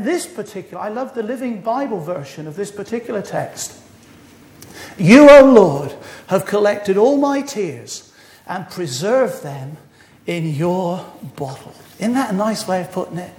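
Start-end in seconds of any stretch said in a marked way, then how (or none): 5.0-11.7 loud opening address, then slow, measured verse; scripture reading. Acted. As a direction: call out to the Lord, then slow right down. Reads favourite Bible translation aloud.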